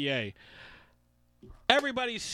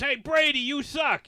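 loud jeering fan shout, higher; mock heckle; 9 semitones higher, 6 dB louder than usual. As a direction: yell it like a heckling Jets fan. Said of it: "Jets fans".

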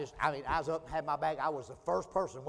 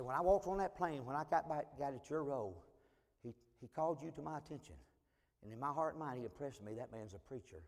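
hushed and much softer; confiding quietly; 3 semitones lower, 9 dB quieter than usual. Retold or imitated. Stranger confessing his past to the preacher, quiet and confiding. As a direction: confess it low and personal. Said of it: "his tone began to change".

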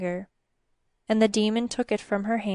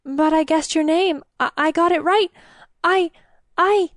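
high, loud panicked stammer; 8 semitones higher, 5 dB louder than usual. Acted. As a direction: stumble over the words in panic. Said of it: "she stammered".

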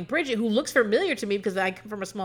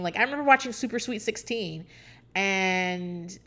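ordinary talk, nothing marked

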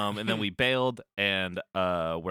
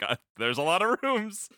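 lightly voiced John; 9 semitones higher than usual. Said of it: "John's like".

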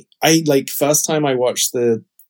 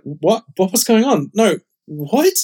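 higher-pitched, mock-confused questions; retold bafflement; 8 semitones higher than usual. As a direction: act out growing disbelief at what you're watching. Acts out confusion as finale ends.

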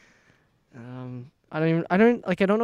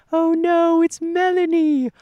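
very high, loud mock British cry; slow, exaggerated British TikTok impression; British accent; 13 semitones higher, 7 dB louder, much slower than usual. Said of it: "in his British accent".

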